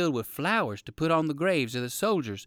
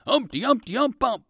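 higher, louder horn toots; imitated horn blast; 9 semitones higher, 5 dB louder than usual. A narrator's reading. Narrator mimicking the big horn, higher and louder. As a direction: toot it like a big brass horn.

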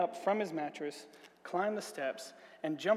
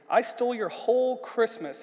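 plain reading voice

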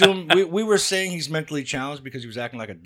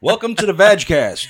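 slow, louder host welcome; podcast host intro; 5 semitones higher, 7 dB louder, slower than usual. Pretend. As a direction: open the show like a host.

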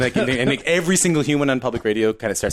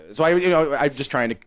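ordinary talk

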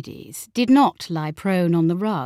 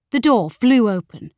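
plain reading voice

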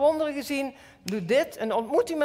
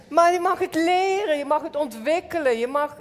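preaching fervor; 6 semitones higher, 5 dB louder than usual. Acted. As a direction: proclaim it with rising fire.

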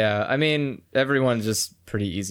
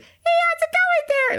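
squeaky falsetto, much higher. Shown as a demonstration. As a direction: squeal it way up high. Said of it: "a really high pitched voice".

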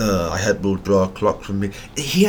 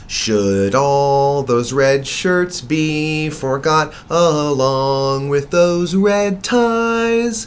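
slow, dramatic recitation; poem reading; 5 semitones higher, 3 dB louder, much slower than usual. Acted. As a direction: read each line like verse. Reads poem she wrote.

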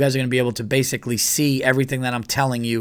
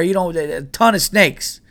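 higher, drawn-out southern twang; mock southern accent; 6 semitones higher, 4 dB louder than usual. Local woman warning hikers about snakes, slow mock southern accent. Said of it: "keep doing a southern accent".

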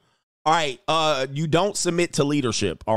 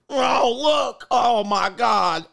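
laughing mock cry; 9 semitones higher, much slower than usual. Mocking the bright screen, laughing.

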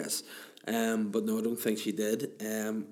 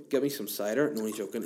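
normal talking voice

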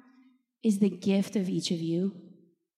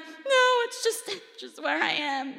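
high-pitched imitation of crying through words; mock-weepy, pitch leaping up then easing down; retold tearful protest; 7 semitones higher than usual. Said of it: "And I just start crying".

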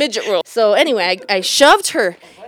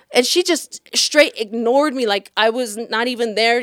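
ordinary talk, nothing marked

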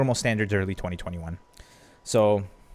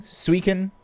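usual speaking voice

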